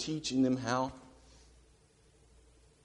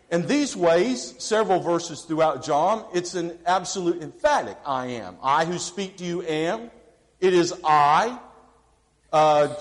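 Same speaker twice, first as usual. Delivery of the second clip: raised, louder preacher's cadence; full-force preaching; 5 semitones higher, 5 dB louder than usual. Acted. Preacher exhorting the congregation about John, fervent full-force preaching. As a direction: drive each I am home from the pulpit.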